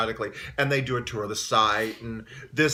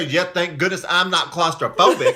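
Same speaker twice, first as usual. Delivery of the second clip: laughing imitation; 5 semitones higher, 6 dB louder than usual. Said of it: "she was sitting there".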